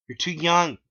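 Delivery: high, loud put-on voice; Clyde's voice. A narrator's reading. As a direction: bark it out as the gatekeeper. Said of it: "he said".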